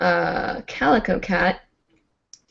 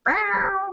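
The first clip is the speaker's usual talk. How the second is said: high-pitched pained cat yowl; drawn-out mock meow; imitated cat cry; 11 semitones higher, 5 dB quieter than usual. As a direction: mimic a cat meowing in distress. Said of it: "he went like".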